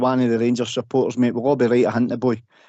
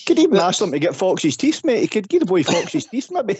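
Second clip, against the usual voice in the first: higher voice, laughing through the joke; real laughter in a higher voice; 8 semitones higher than usual. Real, not acted. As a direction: giggle through the tease about the teeth. Joking about Fox's teeth.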